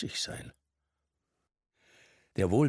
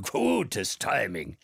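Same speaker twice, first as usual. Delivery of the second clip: lightly voiced old man, slow call; drawn-out pleased call; 3 semitones higher, 6 dB louder, much slower than usual. A narrator's reading. Old man greeting Mark at the bar, lightly voiced. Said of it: "rief der Alte".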